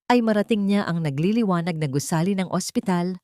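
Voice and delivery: usual speaking voice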